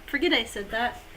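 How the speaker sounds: ordinary talk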